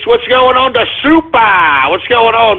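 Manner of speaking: normal talking voice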